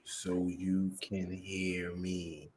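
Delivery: slow, soft and deliberate; slow enunciated. Shown as a demonstration. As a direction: spell each word out slowly. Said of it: "I'ma enunciate".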